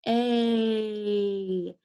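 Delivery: "A" held long and steady; long A sound. Shown as a demonstration. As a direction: stretch out a sustained "A". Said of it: "that long A sound".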